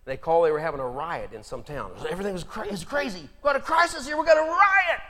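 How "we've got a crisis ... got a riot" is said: alarmed townsfolk. Acted. Townsfolk calling for help.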